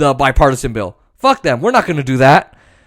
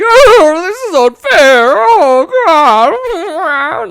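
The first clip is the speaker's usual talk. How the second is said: much higher, louder whiny crying voice; mock whining Republican; 11 semitones higher, 5 dB louder, much slower than usual. Mocking Republicans crying in the media, whiny high voice. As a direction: wail it like a spoiled complainer. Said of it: "they cry in the media".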